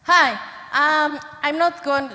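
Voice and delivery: loud, sharp classroom voice; teacher voice. Real, not acted. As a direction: project like calling a class to order. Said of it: "It's my teacher voice".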